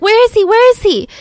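high, loud, frantic repeated question; mother's frantic high cry for her missing son; panicked. Retold or imitated. Mother realises her son is gone.

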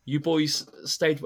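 ordinary delivery

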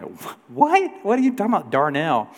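high, startled what, mock confusion; baffled disbelief. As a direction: play the ticket guy totally thrown by the name. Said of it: "the guy was gonna be like".

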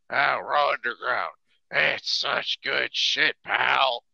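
a hammed-up old boss praising his bad show; imitating Vince. Host mocks Vince praising a bad show, slow and loud. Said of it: "Vince sitting there saying".

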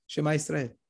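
ordinary delivery

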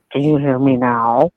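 slow, deliberate ad catchphrase; Verizon commercial. Pretend. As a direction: say it like the phone ad guy. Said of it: "What are we on, Verizon commercial?".

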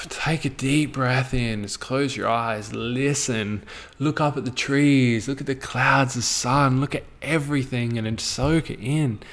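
soft, slow guided breathing; a hint of a meditation guide; guided calm. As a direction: ease them into stillness, softly.

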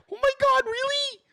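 very high, gushing mock surprise; hammed-up thrilled reaction; surprised delight. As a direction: squeal it like an overjoyed partner. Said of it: "gets you all like".

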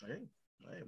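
ordinary talk, nothing marked

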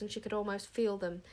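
usual speaking voice

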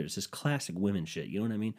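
ordinary talk, nothing marked

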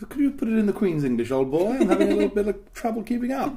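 high, loud mock-posh accent; hammed-up upper-class English accent; posh Queen's English. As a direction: play a posh old-boy drug dealer. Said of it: "The poshest drug dealer".

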